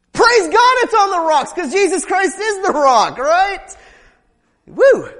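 high, loud joyful preaching shout; loud praise. Real, not acted. Preacher shouting praise to the congregation, loud.

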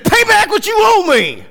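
high, loud furious demand; angry demand. Acted. Unforgiving servant throttling his fellow servant, high furious shout. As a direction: choke it out like a merciless creditor. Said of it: "began to choke him".

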